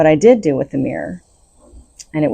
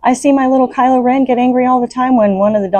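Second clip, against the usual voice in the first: ordinary talk, nothing marked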